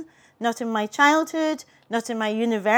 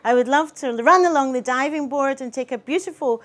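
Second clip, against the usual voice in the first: plain reading voice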